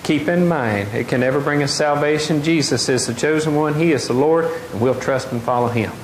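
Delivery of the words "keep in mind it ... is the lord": ordinary delivery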